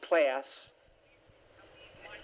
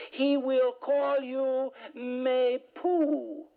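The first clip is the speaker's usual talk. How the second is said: slow, higher mock French preacher; French accent; 6 semitones higher, much slower than usual. Mimicking the preacher to the retreat listeners in French accent. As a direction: savour m'époux in a lofty French way. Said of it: "he's got a French accent".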